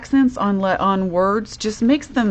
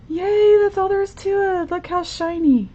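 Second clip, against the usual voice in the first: much higher, bubbly excitement; delighted yay; 10 semitones higher than usual. Real, not acted. Crafter cheers to her viewers that the card is done, high and bubbly. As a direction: celebrate the finished card.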